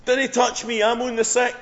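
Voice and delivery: loud, high panicked mock yell; Scottish yell. Scottish Ned jumping away from Jesus, high comic yell. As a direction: holler it in broad Scots to ward someone off. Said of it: "jumps up and yells".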